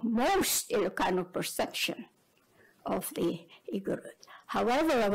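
ordinary delivery

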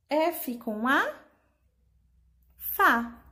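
F com A, Fá; syllable blending. Shown as a demonstration. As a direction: juntar F com A em Fá, alto. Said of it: "Vamos separar as sílabas?". (juntando sílabas devagar)

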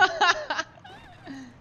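high laugh. Real, not acted. Laughing at joke.

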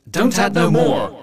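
slow and loud, every word pressed down; firm command. Acted. Telling the folks to stop bringing crayfish, firm loud command. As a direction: lay down the law to the folks. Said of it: "the first thing to do ... is to tell our folks".

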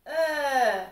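sudden loud grunt as he throws; a sharp heave of a grunt; mimicking a man's throwing grunt; loud grunt of effort. Man grunts throwing stick.

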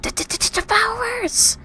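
high, loud stammered cry; high-pitched stuttering shout; frightened yell. Acted. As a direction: yell it out, stuttering on the first sound. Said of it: "Firefly yelled".

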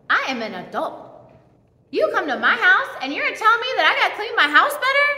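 fast, high, offended come-back; indignant retort. Retold or imitated. Replying to her mom, indignant and fast. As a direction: bristle at being told to clean.

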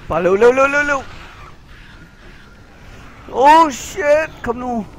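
loud, high, panicked urging; real frantic shouting. Real, not acted. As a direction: egg yourself on in a rush. Frantic escape in game.

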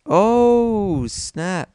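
high, loud, long "oh", then dropping; amazed outburst. Real, not acted. Player amazed by an epic move, high surprised outburst. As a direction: burst out in sudden amazement.

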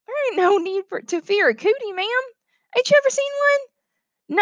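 put-on very high little boy voice; country child calming a grown-up, high-pitched; cheerful reassuring boy. Boy tells teacher not to fear cooties, cheerful high voice.

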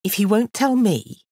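put-on loud, high, indignant girl; frustrated retort.

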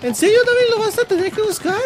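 high mock viewer voice; doubting heckler. Viewer challenges David over the search, high mock voice. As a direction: pitch it high, as a pestering onlooker.

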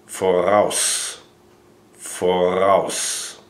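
Voraus as for-OWSS, stress on the second part; German voraus. Shown as a demonstration. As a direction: say Voraus, light r, ending in OWSS. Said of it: "It's spelled V O R A U S".

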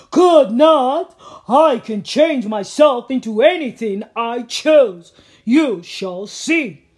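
very loud boastful ogre voice; booming ogre. Acted. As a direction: boom the boast.

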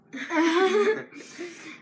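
high laughing fit; helpless laugh. Real, not acted. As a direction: crack up loudly. Laughing after the challenge.

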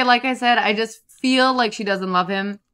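normal talking voice

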